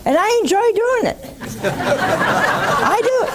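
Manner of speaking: high-pitched, gleeful admission; amused delight. Real, not acted. Host joking about making people miserable, high and amused. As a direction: confess it gleefully, pitch jumping up into laughter.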